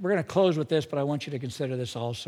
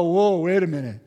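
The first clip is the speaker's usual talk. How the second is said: mock surprise; 5 dB louder than usual. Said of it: "we go".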